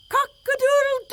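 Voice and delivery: very high crow from the treetop; rooster crowing. Acted. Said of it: "treetop and crowed".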